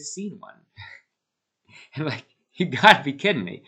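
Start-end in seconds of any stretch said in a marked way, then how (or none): 2.0-3.6 exasperated. Real, not acted. Missed the race entirely.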